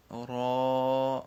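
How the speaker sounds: را said full and thick, slow and stretched; full heavy letter. Shown as a demonstration. Teacher showing students a heavy را, slow and full. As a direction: give را a heavy, full-mouthed r. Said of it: "پر پڑھنا ہے".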